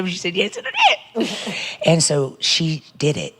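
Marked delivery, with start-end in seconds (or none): 0.0-1.8 high laughing punchline. Real, not acted. A pastor laughing as she tells a single mom's story.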